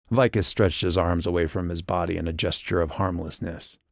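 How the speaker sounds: ordinary delivery, nothing marked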